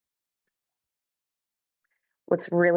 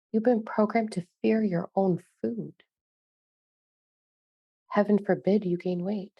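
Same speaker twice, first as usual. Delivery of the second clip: slow, hushed spiritual channeling voice; channeled message. Acted. Channeler speaking to listeners in a slow hushed voice. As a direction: deliver the message like a calm medium.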